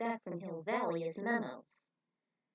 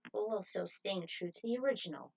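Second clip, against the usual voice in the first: ordinary delivery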